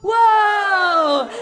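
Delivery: loud, high cry of fright; falling cry. Acted. The rabbit falls from the sky, a loud high cry. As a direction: yelp as you tumble. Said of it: "Rabbit goes".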